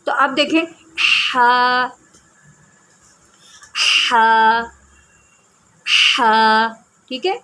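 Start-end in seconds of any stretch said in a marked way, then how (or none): 1.4-6.7 ہا from mid-throat, strong breath; ہا with a firm throaty h, not a light h. Shown as a demonstration. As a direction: push ہا from the middle of the throat. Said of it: "اس طرح آواز نکالنے کی کوشش کریں گے".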